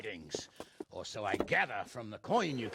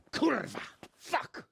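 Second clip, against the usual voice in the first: furious curse; 11 semitones higher than usual. Acted. Lord cursing on hearing the coins are worthless, furious. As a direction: spit out the curses in rage.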